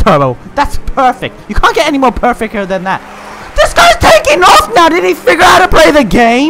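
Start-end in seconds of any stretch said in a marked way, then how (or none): 3.5-6.5 high, loud panicked shriek; shrieking yell. Real, not acted. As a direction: shriek as he pulls ahead. Losing close race.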